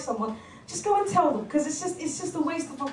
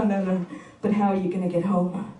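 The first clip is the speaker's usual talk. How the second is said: pitched lower, insistent pestering; a hint of a pushy guy; 7 semitones lower than usual. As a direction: press her, lower than your own voice.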